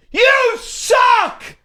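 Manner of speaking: high, loud heckling shout; re-enacted taunt, yelled high; yelling out the window. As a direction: holler it at strangers from a passing car. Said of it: "we're yelling stuff out the window".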